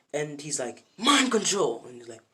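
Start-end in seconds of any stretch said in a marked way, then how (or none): 1.0-1.8 loud, high anime attack call; triumphant acted yell; attack cry. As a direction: shout out the special move. Opponent shouts his attack.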